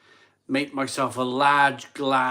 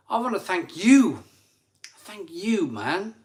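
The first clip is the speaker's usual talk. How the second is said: emphatic thanks; 6 semitones higher, 3 dB louder than usual. Real, not acted. Heartfelt thanks to donor.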